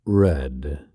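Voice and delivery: low, faraway murmur; vague, drifting. A narrator's reading. Stranger muses to himself, low and vague. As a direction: say it lost in thought, low. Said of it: "said the stranger vaguely".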